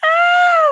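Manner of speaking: loud, high-pitched "ah" after laughter; high laughing cry. Real, not acted. Streamer reacting to her own joke, loud high cry. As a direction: let out a big squeal as the laugh breaks.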